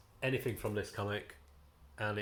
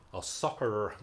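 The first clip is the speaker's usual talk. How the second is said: slow put-on American accent on soccer; slower than usual. Comic reviewer slipping into an American accent for soccer. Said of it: "I did it in that accent".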